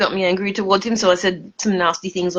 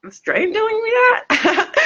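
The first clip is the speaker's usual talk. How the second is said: incredulous; 14 semitones higher than usual. Retold or imitated. Incredulous retort quoted.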